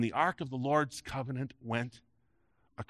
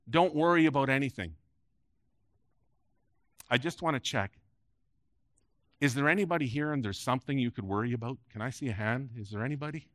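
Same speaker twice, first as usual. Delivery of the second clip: usual speaking voice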